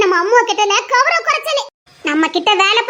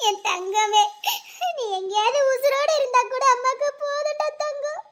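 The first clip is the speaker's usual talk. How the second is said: tearful lament; 2 semitones higher than usual. Acted. Mother laments missing daughter.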